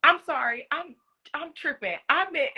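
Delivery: high, flustered cover-up; a hint of panicked backtracking; flustered backpedal. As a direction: scramble to take it back. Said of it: "I was like".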